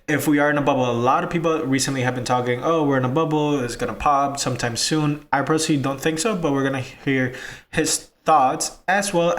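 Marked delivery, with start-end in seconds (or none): none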